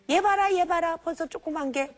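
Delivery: mock scolding. Mother to child asking about babies, scolding and teasing. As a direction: tease the kid for asking too early. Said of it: "이상하게 대접해".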